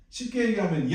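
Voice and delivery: ordinary talk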